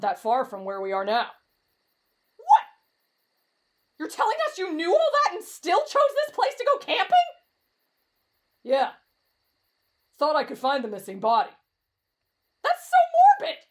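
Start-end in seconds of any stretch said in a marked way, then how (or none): 2.4-7.2 high, incredulous protest; appalled outburst. Acted. Outraged at friend's dangerous camping choice. 12.6-13.6 loud, very high protest; horrified outcry. Acted. Horrified reaction to body search.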